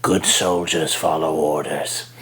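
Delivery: low, slow menacing murmur; low hushed calculated clone; Crosshair's hushed voice. Shown as a demonstration. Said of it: "he talks in hushed tones".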